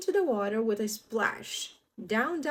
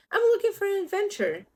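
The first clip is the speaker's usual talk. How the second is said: penguin voice; 11 semitones higher, 5 dB louder, faster than usual. Acted. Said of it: "said Little Penguin".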